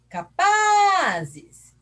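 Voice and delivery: PA in "Capazes" called out long, high and loud; stress on PA. Shown as a demonstration. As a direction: stretch and belt the middle syllable of "Capazes". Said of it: "Quem que é forte?".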